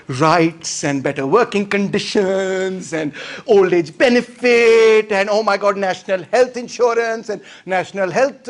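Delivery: slow, drawn-out sarcastic dismay; mock horror. Capitalists forced to give poor people rights, mock horror. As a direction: stretch each item as if it were dreadful.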